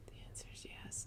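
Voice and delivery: soft whispered inner voice; little inner voice. Retold or imitated. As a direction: whisper it like a secret truth. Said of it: "that little voice was".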